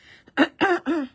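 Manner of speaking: sharp, loud throat-clearing coughs; real coughing fit. Real, not acted. Crafter coughing mid-demo with a frog in her throat, loud coughs. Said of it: "J'ai un chat dans la gorge".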